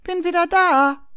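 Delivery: high, loud sing-out; calling out. A narrator's reading. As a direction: announce a return through a door. Sister calls through the locked door.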